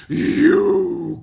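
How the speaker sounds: high, loud storybook cry; wolf's cry. A narrator's reading. Wolf answering Granny at the door, loud high cry. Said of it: "cried the wolf".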